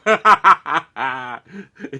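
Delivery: sudden burst of real laughter; loud laugh. Real, not acted. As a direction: laugh hard at your own joke. Laughing at his own joke.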